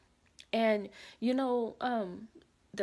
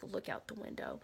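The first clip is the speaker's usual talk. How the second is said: much softer, unvoiced whisper; whispered instruction; 2 semitones lower, 9 dB quieter than usual. The guy whispers to the dreamer to look outside. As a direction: whisper it while pointing outside. Repeated, whispered while pointing.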